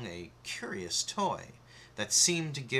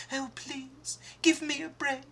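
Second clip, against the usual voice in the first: put-on much higher frightened girl voice; pleading cry. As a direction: beg for your life. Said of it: "She cried".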